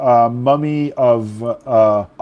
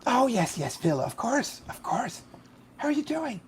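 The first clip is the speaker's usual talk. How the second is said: high, soft, airy mummy rasp; a distracted old mummy, softly delighted; hoarse mummy voice; 12 semitones higher, 9 dB quieter than usual. Mummy bursar Pam greeting Phil, high hoarse rasp. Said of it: "a hoarse, indefinite voice".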